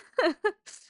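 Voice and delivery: short high laugh choking on emotion; tearful laugh. Real, not acted. Talking about losing someone to suicide, teary laugh. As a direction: laugh it off through tears. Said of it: "those emotions just really hit".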